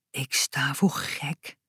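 slow, soft whisper to himself; whispered thought. A narrator's reading. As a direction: breathe it out like a private thought. Boutje's inner thought. (langzaam gefluisterde gedachte)

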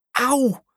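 high-pitched "ow"; cry of pain. A narrator's reading. Boy pushed over in the crowd, pained yelp. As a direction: yelp as if just knocked down, acted.

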